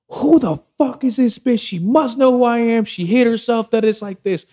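very high-pitched mock outrage; imitated furious ex. Ex storms back in demanding who is there, mock fury. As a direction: shoot the voice way up, imitating her outburst.

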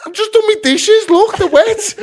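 loud, very high squealed protest; mock frantic self-defence; panicked protest. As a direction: plead innocence in a high, hammed-up voice. Retold protest when accused over knife.

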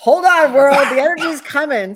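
loud, high burst of energy; excited. Real, not acted. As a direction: call it out like a big entrance. Excited announcement of arrival.

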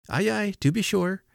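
put-on high, good-humored old fellow; high-pitched old man. A narrator's reading. Deaf old fellow covering his deafness with nods, high-pitched and agreeable. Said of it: "said he in a high-pitched voice".

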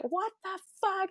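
husband's outburst. Retold or imitated. Husband leaping off the couch at son's news, shocked outburst. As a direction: jump up shocked and blurt it out. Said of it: "literally flew up and was like".

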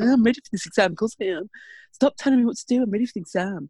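mock the fourteen-year-old brushing off his mum; lightly hammed-up teenage son voice. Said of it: "I don't know why he doesn't talk with that voice".

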